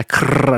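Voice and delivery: rolled whirring machine sound; trilled "krrr" of a machine; scanner noise. Retold or imitated. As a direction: imitate a scanner pulling paper in. Imitating the scanner feeding paper.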